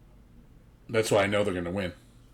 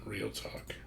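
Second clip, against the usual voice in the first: "Real talk" whispered, softer and unvoiced; 14 dB quieter than usual. Shown as a demonstration. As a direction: breathe it like a secret. Repeats phrase as a whisper.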